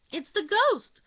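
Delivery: high, loud terrified outburst; scared girl crying out; frightened blurt. A narrator's reading. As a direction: blurt it out before she can stop herself. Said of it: "little Giry blurted".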